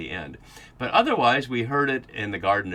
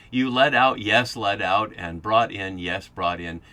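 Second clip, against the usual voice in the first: each verb punched, louder, with a ringing yes; emphatic doubled verbs; 5 dB louder, slower than usual. Shown as a demonstration. Lecturer shows the class the doubled verbs, with strong stress. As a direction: stress "led out" and "brought in" hard, then echo each after a firm "yes". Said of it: "So we could say".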